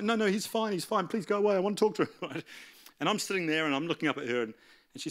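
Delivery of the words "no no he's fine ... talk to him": high, urgent retold waitress; urgent plea. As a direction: mimic her hurriedly waving someone off. Waitress pleads with security guard.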